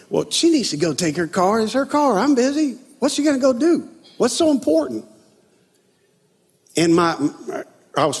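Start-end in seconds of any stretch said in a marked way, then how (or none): 0.1-5.0 higher, whiny inner grumble; mock petty complaint. Husband grumbling inwardly about wife's errand, mock petty complaint. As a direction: act out a self-justifying husband's griping. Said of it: "everything within me wanted to kinda go".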